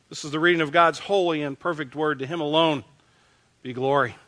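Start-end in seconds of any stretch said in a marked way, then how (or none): none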